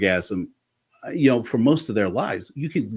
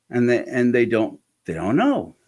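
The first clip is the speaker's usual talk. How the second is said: usual speaking voice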